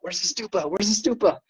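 high, urgent searching call; eager searching. Retold or imitated. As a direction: call out eagerly looking around. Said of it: "we're like".

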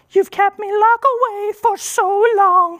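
brain's voice. Retold or imitated. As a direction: play the hurt inner voice, pitched far up and dragged out. His brain speaks to him.

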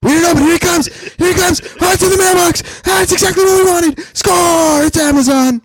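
high, loud play-by-play hype; sports announcer. Retold or imitated. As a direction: call the street like a big game. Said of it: "just narrating what he sees".

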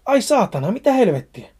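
startled yelp turned into cursing; pained curse. Acted. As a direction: swear at a sudden cut. Cut hand, cursing in pain.

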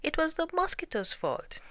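very high accusing iguana; squeaky lizard protest. A narrator's reading. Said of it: "said the iguana".

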